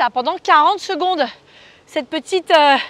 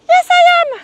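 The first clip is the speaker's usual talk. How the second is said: much higher put-on voice answering herself; a high, cheeky "yes I am" to the coach; mock eager reply; 13 semitones higher, 6 dB louder than usual. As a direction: play the keen student, pitched way up. Answers her own "Ready?" in another voice.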